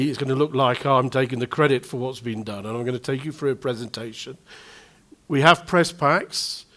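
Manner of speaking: normal talking voice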